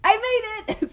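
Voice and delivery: high excited burst of triumph; real little victory cry; triumphant. Real, not acted. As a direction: celebrate the shot, real delight. Scoring a wrapper toss.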